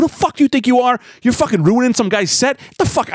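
high, indignant acted tirade; re-enacted angry confrontation; retold snapping. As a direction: get in his face, mock furious. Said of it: "I snap on this guy".

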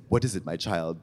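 deep soft priestly voice; a lightly voiced kindly priest. Priest answering, deep soft pastoral tone. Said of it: "he said".

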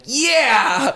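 a high cheer from the crowd in line. Retold or imitated. People in line cheering the Italian guy on, excited. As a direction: egg him on with a whoop.

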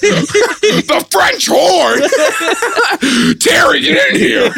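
high laughing yell; laughing shout. Pretend. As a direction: yell for Terry through laughter. Voicing dad yelling, laughing.

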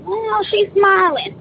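high, slow, startled thought voice; alarmed inner thought. Retold or imitated. Thinking in her head as aunt smiles, alarmed. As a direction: stretch it out in dawning alarm.

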